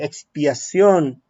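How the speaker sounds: "expiación" said very slowly, every syllable clear; careful pronunciation. Shown as a demonstration. Teacher demonstrating how to pronounce "expiación". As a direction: spell out "expiación" with your voice. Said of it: "pronunciar bien las palabras".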